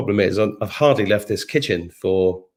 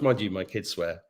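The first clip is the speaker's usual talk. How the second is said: normal talking voice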